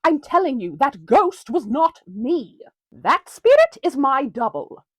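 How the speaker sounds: ordinary talk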